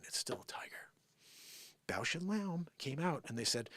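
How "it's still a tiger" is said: whispered aside. Real, not acted. Confiding the truth to viewers, whispered. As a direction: say it under the breath.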